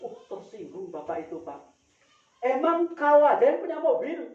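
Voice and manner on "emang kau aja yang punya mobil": higher, louder indignant snap; offended passenger snapping back; angry retort. Retold or imitated. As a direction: retell the official's angry outburst. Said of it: "Oh, marah sekali itu".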